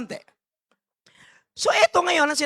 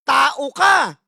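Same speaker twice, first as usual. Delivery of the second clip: emphatic shout; 3 semitones higher, 7 dB louder than usual. Real, not acted. Preacher exhorts congregation.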